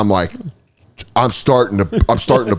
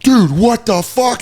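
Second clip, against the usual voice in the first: high, drawn-out mock alarm; freaked-out friend; 11 semitones higher, slower than usual. Friend grabbed in the crowd, high panicked imitation. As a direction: stretch it out in wild-eyed panic.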